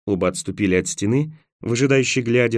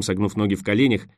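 ordinary delivery, nothing marked

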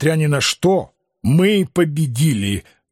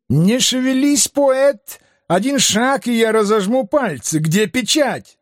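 high, strained threatening yell; frantic threat; 5 semitones higher than usual. Acted. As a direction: threaten him, half-crazed. Crazed bookseller threatening at cliff.

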